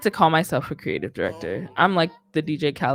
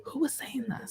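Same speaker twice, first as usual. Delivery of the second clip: whisper; 6 semitones higher, 11 dB quieter than usual. Real, not acted. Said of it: "wondering aloud mid-chat".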